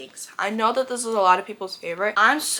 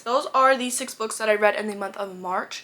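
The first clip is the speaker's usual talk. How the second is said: ordinary delivery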